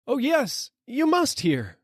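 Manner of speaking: insist, begging to be heard; crying out. A narrator's reading. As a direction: rider crying down to the dealer. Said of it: "I cry".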